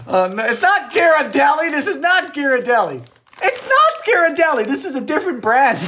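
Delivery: high-pitched amused exasperation; exasperated protest. Real, not acted. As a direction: insist to teasing viewers, voice climbing. Protests at viewers' brand guesses.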